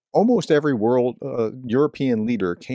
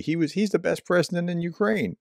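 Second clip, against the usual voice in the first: higher, lightly mocking imitation of Trump's boast; mock Trump quote; 7 semitones higher than usual. Host lightly mocking the president's praise, with a higher imitation. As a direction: toss it off as a lightly hammed-up boast. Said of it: "He was, like".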